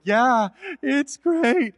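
slow, unconvincing fake enthusiasm; half-hearted agreement. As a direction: pretend you like it while dreading it. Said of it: "I'm not really feeling it".

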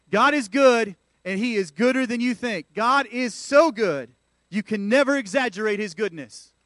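loud, fervent preaching; full-force preaching. Acted. Pastor rousing the congregation in full preaching voice. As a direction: drive it home from the pulpit.

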